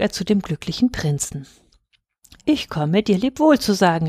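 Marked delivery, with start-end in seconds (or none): none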